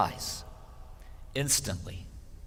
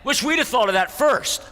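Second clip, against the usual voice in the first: indignant grumble; 8 semitones higher, 5 dB louder than usual. Retold or imitated. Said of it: "They're all kind of indignant".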